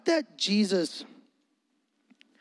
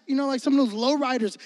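ordinary delivery

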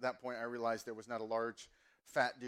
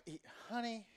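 a light imitation of his Southern mom; mom's Southern accent; 8 semitones higher, 7 dB quieter than usual. Mom greeting her son, light Southern accent. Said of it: "every time I do my mom's accent".

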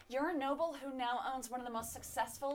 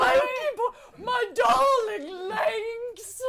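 man doing a woman's voice; 10 semitones higher, 10 dB louder, much slower than usual. Pretend. Imitating the lady wailing for her dog.

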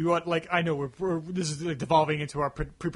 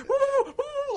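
high, manic cartoon whooping; zany worked-up "woo, woo" hoots; Daffy Duck. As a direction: whoop wildly, voice leaping way up. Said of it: "just acted like Daffy Duck".

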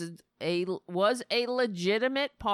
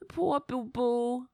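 mock baby talk; 2 semitones higher, slower than usual. Mocking Trump as a baby.

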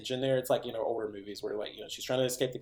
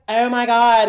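high, loud "oh my God"; shocked gasp; 11 semitones higher, 13 dB louder than usual. Retold or imitated. Girl reacting to the murder news on the radio, high loud shock. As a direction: blurt it out in horror. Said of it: "and she's like".

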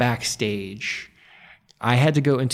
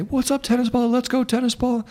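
high-pitched mocking taunt; heckling guy's voice; 14 semitones higher than usual. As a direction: imitate an obnoxious fan in a high voice. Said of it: "this guy's voice became, like, a trigger".

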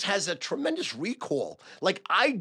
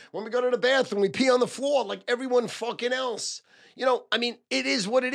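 excited; 8 semitones higher, 4 dB louder than usual. Real, not acted. Excited rant about fans' love.

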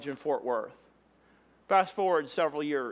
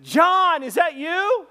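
high, loud holler across a distance; calling out; 12 semitones higher, 8 dB louder, slower than usual. Retold or imitated. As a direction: shout in astonished recognition. Calling to friend on the beach.

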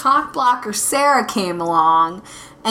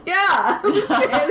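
laughing; 6 semitones higher than usual. Retold or imitated. Lying about being Australian, laughing.

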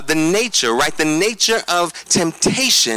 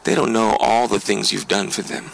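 tempter's voice; 7 semitones lower than usual. Acted. Pastor voicing the enemy to the congregation, low and sly. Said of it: "The enemy steps in and says".

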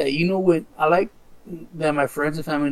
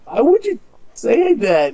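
higher, louder and drawn out, imitating an offended stranger; retold cry of disbelief, slow and high; mock indignant protest; 7 semitones higher, 5 dB louder, slower than usual. Stranger objects to a troll's dog insult, mock indignant.

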